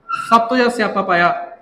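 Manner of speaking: usual speaking voice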